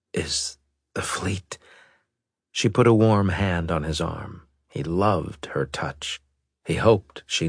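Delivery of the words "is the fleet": slow faint unvoiced whisper; barely voiced, slow and weak. Weak admiral just waking.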